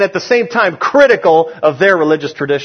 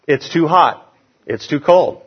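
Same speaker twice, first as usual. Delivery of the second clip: ordinary delivery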